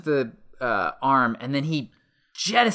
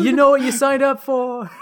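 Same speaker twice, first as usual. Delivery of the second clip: high, loud put-on holler; mock shout; 10 semitones higher, 6 dB louder than usual. Joining the joke of yelling back, high mock shout. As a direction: holler it high, as if calling after someone. Joke of yelled exchange, played out.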